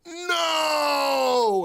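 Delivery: a father yelling no in alarm; dad shouting no. Retold or imitated. Dad cutting in on the French, a loud high shout. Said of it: "my dad was like".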